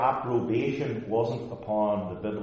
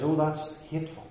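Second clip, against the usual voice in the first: mock disapproval, pitched up; higher, scandalized worldly objector; 6 semitones higher than usual. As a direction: put on a shocked tut-tutting tone. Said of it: "The world says".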